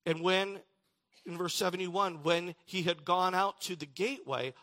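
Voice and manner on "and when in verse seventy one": ordinary talk, nothing marked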